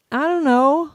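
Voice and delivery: high, helpless, rising reply; mock tongue-tied answer. Fan asked what she's been doing, high helpless answer. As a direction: play up being caught without words.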